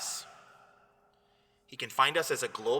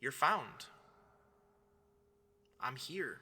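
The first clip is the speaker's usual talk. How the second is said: soft, hushed words with long pauses; hushed, unhurried divine reassurance; near-whisper; 9 dB quieter than usual. Acted. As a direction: speak gently and slowly as God whispering to someone. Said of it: "he whispers to some of us".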